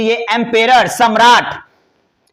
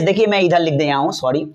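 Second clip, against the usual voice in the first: ordinary delivery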